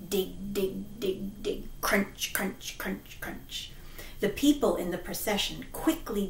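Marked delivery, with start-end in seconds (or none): 0.1-1.6 soft digging sounds. Acted. 1.8-3.7 breathy whispered crunch crunch; unvoiced crunching noises dying away; whispered crunches.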